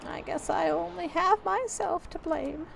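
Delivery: high, slow granny voice; acted high, sorrowful old-lady voice; resigned old lady. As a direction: sigh it out slowly as a defeated old woman. Old tenant accepts eviction.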